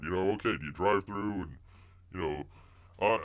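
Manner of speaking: usual speaking voice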